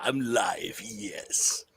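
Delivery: whispered executioner voice; menacing hushed executioner; Crimson Executioner voice. Pretend. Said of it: "talking like the Crimson Executioner".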